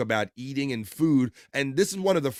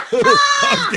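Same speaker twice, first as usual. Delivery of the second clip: laughing hard; 10 dB louder than usual. Real, not acted. Host watching gross clip.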